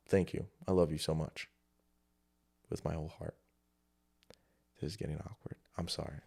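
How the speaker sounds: very low, soft, heartfelt murmur; quiet sincerity. Real, not acted. Vlogger thanking viewers who stayed, hushed and sincere. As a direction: drop right down, almost under your breath.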